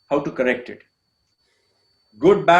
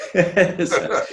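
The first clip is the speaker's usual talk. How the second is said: laughing; 3 semitones higher than usual. Real, not acted. Laughs at his own emotion.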